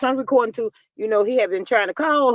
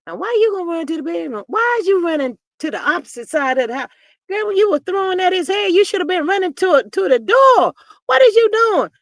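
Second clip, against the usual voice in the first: high, rising, louder scolding; incredulous mock scolding; 8 semitones higher, 5 dB louder than usual. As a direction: yell at her as if she could hear you. Scolds the absent girl in story.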